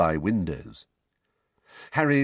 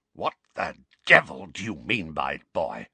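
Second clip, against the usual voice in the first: croaky furious voice; 3 semitones lower than usual. Acted. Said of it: "croaky voice that trembled with fury".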